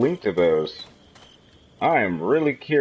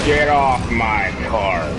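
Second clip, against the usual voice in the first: slow drawn out; 4 dB louder, much slower than usual. Retold or imitated. Quoting game line, slowed.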